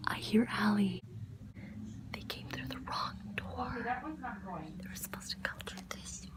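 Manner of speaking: steady hushed whisper. Real, not acted. Hiding in the house, whispering her surprise plan. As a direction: whisper the plan so no one hears.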